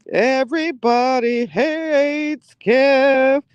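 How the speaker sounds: slow, drawn-out mock announcement; mock title. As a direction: stretch it like a show title. Mock-sing sitcom title.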